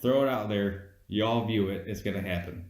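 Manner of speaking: ordinary delivery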